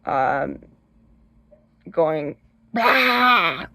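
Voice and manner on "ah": high, loud mock scream; screaming woman. Shown as a demonstration. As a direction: scream as if a bee just appeared. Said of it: "frickin' screaming woman".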